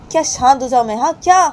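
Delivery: high, loud angry outburst; shrieking mock rage about Rama; furious girl. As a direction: explode at the friend. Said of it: "तो बानी बोलती है".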